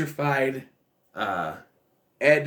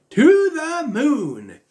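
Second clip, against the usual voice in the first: high, loud, stretched-out hype call; soaring, long-held mock-epic cry; hyped-up exclamation; 13 semitones higher, 7 dB louder, much slower than usual. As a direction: draw it out slowly, way up high and loud. Drawn-out exaggerated verdict on the film.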